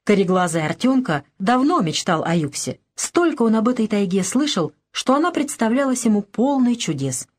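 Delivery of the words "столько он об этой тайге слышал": plain reading voice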